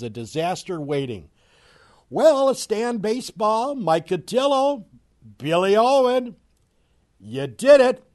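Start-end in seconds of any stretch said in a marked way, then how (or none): 2.1-8.0 loud mocking call-out. Real, not acted. Mocking Pirates executives.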